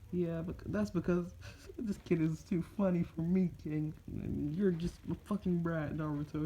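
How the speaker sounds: high put-on bickering voice; retorting fox. Fox Kurama calls Naruto a brat, high-voiced retort.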